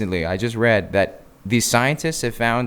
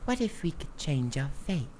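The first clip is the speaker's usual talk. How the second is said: high, halting put-on accent, overdone; hammed-up terrible Sharon Tate accent, pitched high; bad Sharon Tate accent; 8 semitones higher, 4 dB louder than usual. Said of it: "her accent for Sharon Tate, it's literally like".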